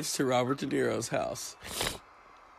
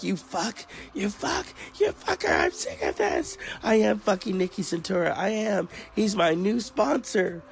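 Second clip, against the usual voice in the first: shrieking high mock rant, building fast; hammed-up hysterical actress, pitched way up; imitating a drunken screaming fit; 8 semitones higher than usual. Acting out Sharon Stone's meltdown for the cohost, shrill high mock rant. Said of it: "She's like".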